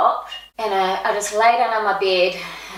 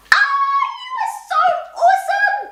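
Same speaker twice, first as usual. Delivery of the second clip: much higher, louder, squealing over-the-top praise; gushing excitement; 4 dB louder than usual. Retold or imitated. To the fifteen-year-old rugby girl, hammed-up gushing praise. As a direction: gush at her like a thrilled fan. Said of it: "I wanted to, like, be like".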